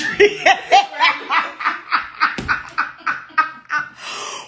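long high cackling laugh; big laugh. Real, not acted. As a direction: burst into a long run of laughter. Cracking up at own story.

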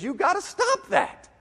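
high, slow, drawn-out acted reprimand; high-pitched imitation scolding; mock exasperated rebuke.

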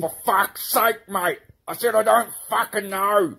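high, loud exasperated yelling; angry outburst. Acted. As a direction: snap back in frustration. Annoyed at repeated questions.

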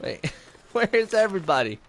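usual speaking voice

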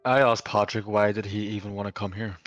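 normal talking voice

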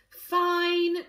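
loud, drawn-out grudging agreement; unhappy sigh. A narrator's reading. Politician father agrees to a speech, sighing unhappily. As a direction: give in with a heavy sigh. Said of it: "Corvus sighed unhappily".

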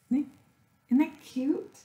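slow, high delighted coo over a cute find; high gushing squeal. Real, not acted. Crafter gushing to viewers over a cute sticker, high delighted coo. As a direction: melt over it in a high drawn-out voice.

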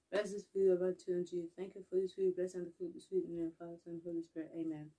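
soft murmured grace before lunch; quiet mealtime prayer. Acted. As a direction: pray quietly over the food. Blesses the food.

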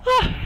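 very high, loud scream of fright; terrified shriek. Real, not acted. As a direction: shriek as the monster jumps out. Monster appears suddenly.

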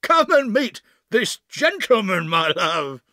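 high, loud theatrical call; projected, hammy hail; calling downstairs. Acted. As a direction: call out to your wife on another floor. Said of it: "He turned to call downstairs".

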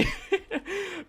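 soft, very high laughter; laughing. Real, not acted. Player laughing at own lone-wolf remark, high soft laugh. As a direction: crack up in a high, quiet laugh.

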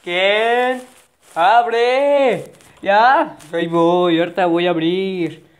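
loud call at the door. Acted. Someone knocks and calls to open, loud. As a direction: shout through the door.

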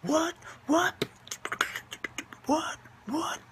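high surprised yelps; shocked. Real, not acted. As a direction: squeak it in disbelief. Repeated high shocked what.

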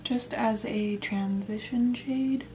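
slowed right down; slow, careful wording. Real, not acted. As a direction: say it slowly, as if careful not to trip over it. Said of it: "I had to say that slow".

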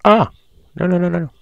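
high, quick panicked no's; alarmed as it goes wrong; sudden high-pitched dismay. Real, not acted. Something goes wrong in game.

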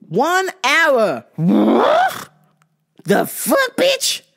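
high, rising shocked exclamations; outraged disbelief. Real, not acted. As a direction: react with stunned outrage. Outrage at one hour wait.

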